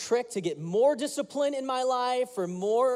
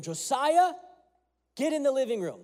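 a hint of a stern father calling, higher and louder; stern dad; 6 semitones higher, 3 dB louder than usual. Father summoning his son to the living room, stern. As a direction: call the boy in like a stern dad. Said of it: "it was a stern kind of tone".

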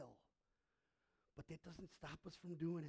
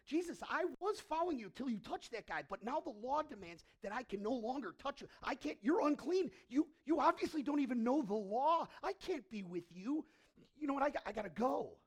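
indignant follower; 7 semitones higher, 10 dB louder than usual. Retold or imitated. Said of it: "could have been like".

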